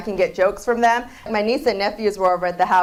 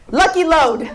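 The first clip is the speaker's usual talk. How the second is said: put-on higher accented "Lucky Load"; mom's mangled flavor name, brisk; imitating mom; 5 semitones higher, 3 dB louder, slower than usual. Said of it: "She goes".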